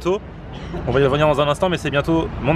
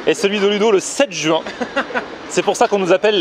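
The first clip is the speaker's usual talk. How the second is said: excited; 7 semitones higher than usual. Real, not acted. Upbeat outro announcement.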